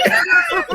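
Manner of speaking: loud high burst of laughter; high shrieking laugh. Real, not acted. Cohost cracking up at the offline joke, shrieking laugh. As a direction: cackle out loud in delight.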